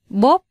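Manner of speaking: a sharp mouth-made slap, loud and high; loud spoken slap sound. Acted. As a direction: smack it out like a hard slap.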